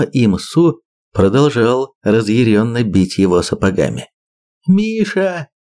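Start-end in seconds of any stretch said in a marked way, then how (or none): 4.7-5.5 high desperate yell. Acted. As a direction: scream his name in despair. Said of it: "заорала Лера".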